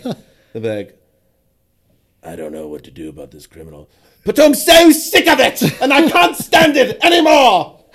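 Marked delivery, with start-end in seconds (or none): 2.2-3.9 low, hushed, controlled cop voice; British actor's American cop. Acted. British actor playing a Baltimore cop, low and controlled. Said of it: "If they have to raise their voice". 4.2-7.8 high, full-volume hammed-up outburst; accent-breaking yell. Mocking British actors who must raise their voice, hammed-up yell. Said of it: "If they have to raise their voice".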